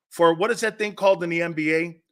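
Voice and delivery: ordinary delivery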